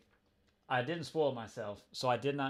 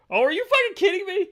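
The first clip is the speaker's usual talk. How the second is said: high, loud, rapid shriek; outraged disbelief; 11 dB louder, faster than usual. Real, not acted. Reader reacting to the redhead reveal, shrieking incredulously. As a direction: burst out in real disbelief.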